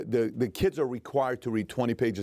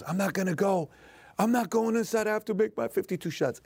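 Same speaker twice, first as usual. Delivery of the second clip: put-on much higher, tearful protest of a sick boy; imitating crying child; 8 semitones higher than usual. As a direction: play the son refusing through tears, pitched up. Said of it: "And he cries".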